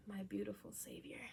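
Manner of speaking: unvoiced loving whisper; soft whispered aside. Real, not acted. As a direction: whisper it fondly.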